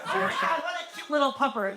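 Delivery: high mock-cute voice, half laughing; cutesy mock voice. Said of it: "I'm not fucking talking like that".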